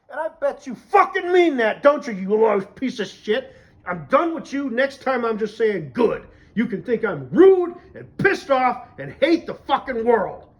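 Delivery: loud, high, furious tirade; angry rant. Real, not acted. Done with polite small talk, angry rant. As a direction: blow up at them, punching the swear words.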